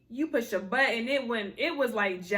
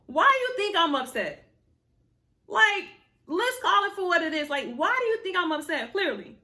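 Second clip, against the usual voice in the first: indignant venting; 5 semitones higher, 4 dB louder than usual. Real, not acted. Venting to viewers about dumb question.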